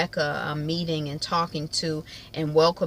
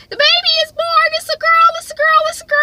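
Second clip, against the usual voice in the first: very high, loud squealing voicemail; hammed-up shrieking excitement; ecstatic announcement; 11 dB louder, faster than usual. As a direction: act out an overjoyed new grandmother. Mother's excited voicemail announcing birth.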